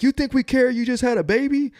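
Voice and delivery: high, sneering mock instructor voice; cold instructors taunt a new father, high and sneering; mocking drill instructor. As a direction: jeer at the rookie in a put-on voice.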